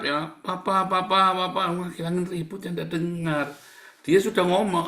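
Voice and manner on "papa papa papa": high, loud repeated calling; mock shouting. Believer calling Papa over the storm, shouting. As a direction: imitate a child yelling for father through wind. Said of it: "Kamu teriak-teriak".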